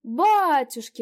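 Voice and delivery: loud, high surprised cry; exclamation. A narrator's reading. Landlady recognises the guest, crying out in surprise. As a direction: throw up your hands in surprise. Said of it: "воскликнула старуха".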